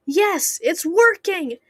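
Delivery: high, loud, triumphant cry; triumphant shout. A narrator's reading. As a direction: shout it in victory. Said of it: "Herobrine shouted triumphantly".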